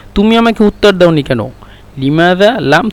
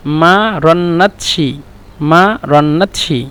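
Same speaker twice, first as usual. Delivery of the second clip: মা রন্নাতশি said very slowly, syllable by syllable; much slower than usual. Shown as a demonstration. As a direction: spell out মা রন্নাতশি slowly, word by word. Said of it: "একসাথে বলে মারান্নাতশ". (মা রন্নাতশি ধীরে ধীরে ভেঙে ভেঙে বলা)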